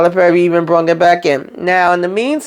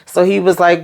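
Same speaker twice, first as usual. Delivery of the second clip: normal talking voice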